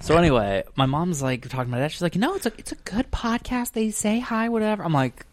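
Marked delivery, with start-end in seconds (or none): 2.2-4.8 high mock mom voice; breezy high mom impression; imitating mom. Mom voiced in retold chat.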